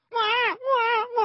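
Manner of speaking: high wailing newborn cry; imitated baby wail. The newborn crying right after birth, a high imitated wail.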